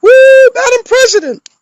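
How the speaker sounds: mocking wealthy Clinton fans cheering their win; mock cheering wine moms. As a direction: whoop like a tipsy rich supporter celebrating. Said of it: "all the guys with the little styrofoam pork pie hats".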